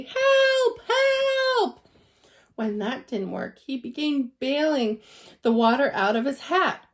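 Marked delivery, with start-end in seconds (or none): 0.0-1.9 high, loud cry for help; calling loudly. Acted. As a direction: yell for help from the bath. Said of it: "then very loudly".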